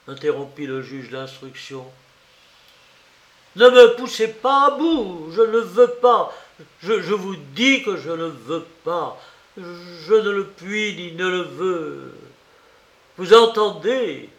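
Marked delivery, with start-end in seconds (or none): 3.5-14.3 high furious shout, easing then flaring; loud, enraged ultimatum; thundering voice. A narrator's reading. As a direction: bellow in rage, stammering as it goes. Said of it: "reprit d'une voix de tonnerre".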